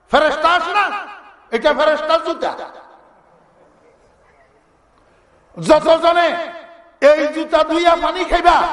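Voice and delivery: high, emphatic proclamation; vizier addressing a crowd. Acted. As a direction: proclaim it to gullible worshippers with authority. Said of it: "উজিরা কয়".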